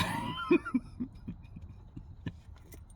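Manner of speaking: helpless laughing fit, high and breathless. Real, not acted. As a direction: crack up, lose it completely. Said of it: "I'm just laughing".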